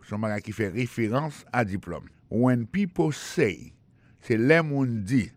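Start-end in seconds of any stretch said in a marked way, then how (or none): 2.3-3.7 slow, separated English words; slow model phrase. Shown as a demonstration. Teacher modelling a phrase to remember, slow and deliberate. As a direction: say it slowly for learners.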